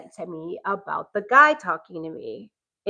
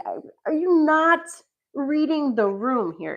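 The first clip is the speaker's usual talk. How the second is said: exasperated; 8 semitones higher than usual. Retold or imitated. Exasperated quote to him.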